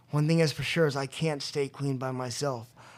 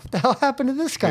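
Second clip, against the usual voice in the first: high, quick incredulous outburst; baffled astonishment; 10 semitones higher, 4 dB louder, faster than usual. Retold or imitated. Friend imagined seeing relapsed newcomer, baffled and astonished. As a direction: rush it out in high-pitched disbelief.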